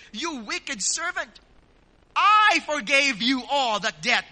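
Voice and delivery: loud, indignant rebuke, hardest at the start; angry master. Acted. Master rebuking his unforgiving servant, angry and loud. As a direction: thunder the accusation, then press the question home. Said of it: "his master was angry".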